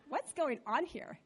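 soft, puzzled little questions; hushed mock bewilderment; imitated unimpressed kids. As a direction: mimic children quietly baffled by the parent. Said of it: "they just look at me like".

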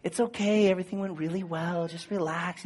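soft, soothing calm-down; sweet reassurance. Retold or imitated. Anesthesiologist soothing a waking patient, soft and kind. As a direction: gently settle a groggy patient. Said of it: "they're just so nice".

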